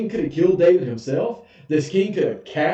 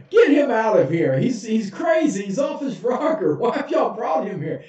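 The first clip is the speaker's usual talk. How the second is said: higher, shouted orders; much higher, exasperated yell; exasperated king; 7 semitones higher than usual. Retold or imitated. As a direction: throw him out, fed up.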